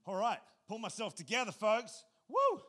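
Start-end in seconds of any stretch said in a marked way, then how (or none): 2.3-2.6 loud, high whoop of self-encouragement; rallying cry before preaching; excited whoop. Real, not acted. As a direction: let out a big pumped-up woo. Pastor psyching himself up.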